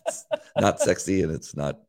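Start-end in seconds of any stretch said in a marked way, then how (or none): 0.1-0.2 laughing. Real, not acted. Laughing off compliment.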